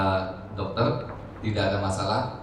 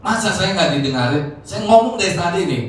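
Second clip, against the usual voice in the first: raised-pitch mock indignation; acted-out protest, higher and louder; retold angry grumbling; 7 semitones higher, 4 dB louder than usual. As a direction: imitate a father still cross and complaining. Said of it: "masih marah-marah terus".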